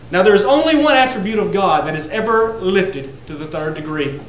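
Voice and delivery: ordinary talk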